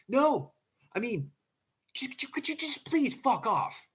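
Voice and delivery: high, loud exasperated plea to be left alone; harsh outburst. Retold or imitated. Man tells girlfriend to leave, harsh and shrill with exasperation. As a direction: stumble into it, then burst out high and harsh. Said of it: "that was very harsh".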